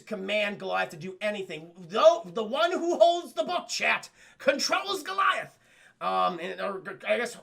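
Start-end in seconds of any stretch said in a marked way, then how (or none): 1.8-5.6 loud, high, drawn-out narrator reveal; dramatic proclamation. A narrator's reading. Storyteller telling chat who controls Goliath, grandly. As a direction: boom it out slowly, hammed-up.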